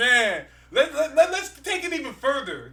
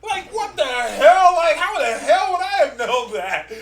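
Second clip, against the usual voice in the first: raised pitch, loud incredulous outburst; exasperated disbelief; 6 semitones higher, 6 dB louder than usual. Real, not acted. Baffled by the comic's slang, exasperated disbelief. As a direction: stretch it out in loud, baffled protest.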